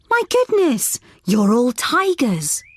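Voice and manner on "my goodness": higher, gasping surprise; surprised. Acted. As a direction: act amazed at the children. Teacher gasps at tiger children.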